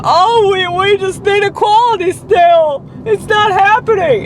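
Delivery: high, mocking nasal imitation of a preachy person; mocking whiny voice. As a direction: sneer it in a high mock whine. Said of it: "the more people go".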